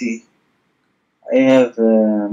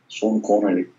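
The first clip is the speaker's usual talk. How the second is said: lightly voiced, softer impression; Sean Connery; 3 semitones lower, 6 dB quieter than usual. Shown as a demonstration. Showing his friend the sound, understated Connery impression. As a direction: say it softly with a slushy SH. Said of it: "but with lower voice, with a Sh-, Sean Connery".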